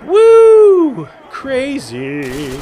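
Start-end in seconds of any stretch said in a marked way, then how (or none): 0.1-1.1 high loud victory whoop; excited whoop. Real, not acted. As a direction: whoop with triumph. Celebrating kill.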